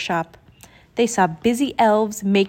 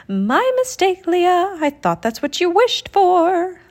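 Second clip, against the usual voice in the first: high genie voice; 9 semitones higher than usual. Acted. Genie apologizing.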